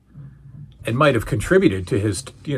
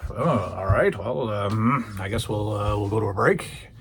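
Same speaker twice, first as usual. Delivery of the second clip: lower, softer, halting host voice; hesitant host; 5 semitones lower, 6 dB quieter than usual. Retold or imitated. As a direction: play an uneasy host stalling toward the break. Voicing Vince hesitantly sending to break.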